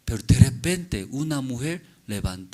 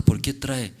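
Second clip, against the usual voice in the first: ordinary talk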